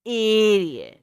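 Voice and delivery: a loud playful shout at a friend. Real, not acted. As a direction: yell it out loud, mock-outraged. Co-host talking right in her ear.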